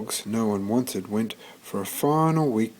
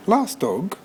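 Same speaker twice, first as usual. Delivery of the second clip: shelter lady puzzled; 9 semitones higher than usual. Acted. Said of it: "to the lady in the office".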